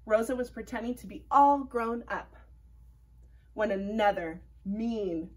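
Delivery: ordinary delivery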